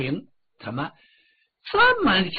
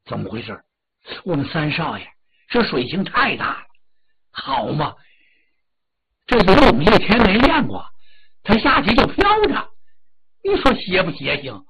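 amazed exclamation that bursts out louder in the middle; astonished; 6 dB louder than usual. Acted. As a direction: marvel out loud at the young master's swimming. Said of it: "简直把他惊呆了".

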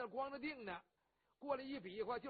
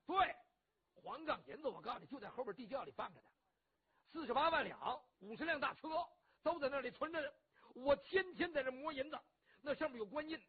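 put-on loud, higher, bragging to his old friend; blustering rough hero; 4 semitones higher, 6 dB louder than usual. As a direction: boom it out, boastful and warm-hearted. Cheng Yaojin voiced.

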